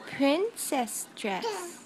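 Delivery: very slow, every sound stretched; teaching the word "Princess dress" very slowly; slow, drawn out teaching. Shown as a demonstration. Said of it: "teaching toddler a word".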